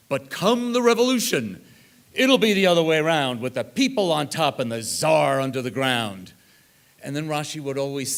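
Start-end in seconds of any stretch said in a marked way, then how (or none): 0.1-6.3 building to a loud declaiming climax; rising into a loud, half-mocking rallying call; mock rallying cry. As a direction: proclaim the revolution like a soapbox orator. Retold rallying prophecy of grandfather.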